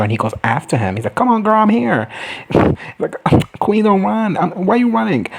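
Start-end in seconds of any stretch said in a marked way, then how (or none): 1.2-2.1 high, eager put-on voice; eager calling out. Dragon chasing the rider he chose, eager calling. 3.6-5.3 high, whiny pleading call; mock pleading. Dragon begging the fleeing rider to stop, mock pleading.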